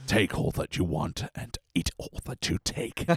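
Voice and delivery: low, wet slobbering voice; slobbering alien. Retold or imitated. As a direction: slobber through the line. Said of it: "You really get that slobber".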